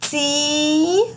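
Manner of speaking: teacher stretching تين; long drawn vowel. Shown as a demonstration. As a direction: hold the ee in تين. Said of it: "بعديها ياء".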